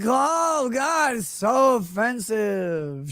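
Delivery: slow, drawn-out mocking wail; mock outrage. Comic imitates everyone offended by a tweet, drawn-out mock outrage. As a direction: stretch every word into hammed-up offence.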